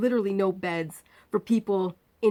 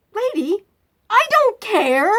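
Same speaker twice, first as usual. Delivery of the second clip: much higher and loud, slow; retold yell; 10 dB louder, slower than usual. Patient yelling at the surgeon, retold yell. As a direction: shout it, fed up.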